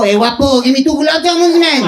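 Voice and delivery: put-on high-pitched comic Mexican accent; mock Mexican accent. Indian from Mexico ordering sweets from a guy, mock Mexican accent. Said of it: "There are Indians in Mexico".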